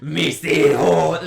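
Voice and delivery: slow, drawn-out growl; growling old man voice. Retold or imitated. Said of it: "her old man voice".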